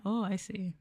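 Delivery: ordinary delivery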